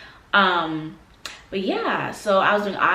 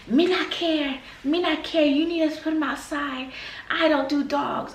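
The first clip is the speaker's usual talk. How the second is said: higher Jamaican patois, firm; Jamaican mom accent; 8 semitones higher than usual. Retold or imitated. Jamaican mom refusing the dog, higher stern patois. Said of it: "So she's like".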